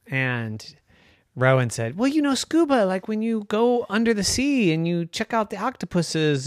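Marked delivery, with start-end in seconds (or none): 2.0-6.5 put-on high, chatty child voice; a kid explaining excitedly; eager little unicorn. Rowan explains.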